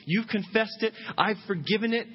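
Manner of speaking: rally them like a coach; rousing voice of God. Retold or imitated. God urging the forgiven child back to work, rousing.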